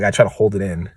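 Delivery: ordinary talk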